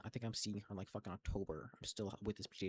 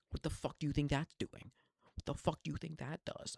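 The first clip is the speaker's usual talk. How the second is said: louder, higher angry demand; angry outburst; 4 semitones higher, 6 dB louder than usual. Real, not acted. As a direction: fire it at the doctor. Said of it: "venting at doctor now".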